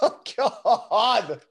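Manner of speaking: high burst of real laughter; laughing. Real, not acted. Laughing at the joke's punchline, real laughter.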